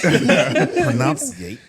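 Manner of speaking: slow, loud newscaster-style impression; Brian Gumble impression. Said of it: "let me get my Brian Gumble voice".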